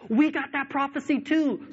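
a cheery church voice one-upping Paul; mock church voice.

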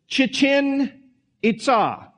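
Chichen Itza with emphasis on the last part, Itza; careful pronunciation. Shown as a demonstration. Preacher tells the congregation how to pronounce it, careful. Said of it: "I'll do the best I can to pronounce it".

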